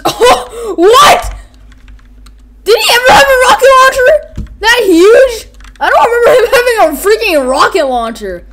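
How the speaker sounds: high, loud disbelieving yell; loud incredulous exclaiming; shocked outburst. Real, not acted. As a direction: shriek it in astonishment. Startled by rocket launcher.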